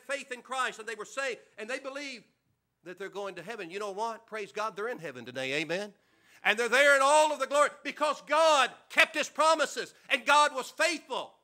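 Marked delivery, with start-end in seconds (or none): 6.4-11.3 ringing out louder, slowing at the end; loud preaching climax, stretched last words. Real, not acted. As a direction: proclaim it to the back pew. Preaching climax about God's promises.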